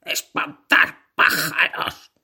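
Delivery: a farmer's slow, stern command, last words breathed out; lightly voiced farmer, slowing to a breathy finish; farmer giving orders. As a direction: tell him his job, lingering on the last words.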